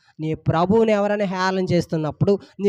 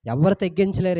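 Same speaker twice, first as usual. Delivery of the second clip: ordinary talk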